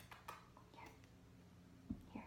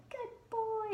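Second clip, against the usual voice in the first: put-on much higher "Good boy" sung out; high-pitched praise. Shown as a demonstration. Trainer showing the wrong way to praise, high-pitched. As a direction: squeal the praise as if to amp him up. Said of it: "in a really high-pitched voice".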